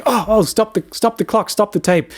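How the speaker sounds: high-pitched urgent interruption; acted-out flustered outburst; re-enacted urgent halt. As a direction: call a sudden halt to the session.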